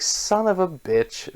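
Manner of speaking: slow, high, drawn-out groaning curse; exasperated curse. Retold or imitated. Wounded warrior cursing his fate on the bridge, high and slow. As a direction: drag the curse out high and slow like he can't believe it.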